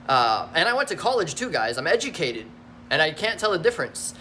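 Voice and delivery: normal talking voice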